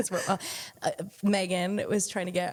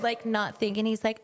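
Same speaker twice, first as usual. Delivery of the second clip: ordinary talk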